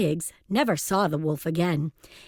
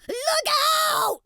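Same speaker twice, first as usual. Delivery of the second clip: very high-pitched squeal of warning; panicked cry. A narrator's reading. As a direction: squeal it in alarm. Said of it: "he cried".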